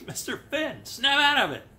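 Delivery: high, hammed-up call that gets loud; mock rousing yell. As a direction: play at shaking him out of a daze. Interviewer rousing a dazed Fenn.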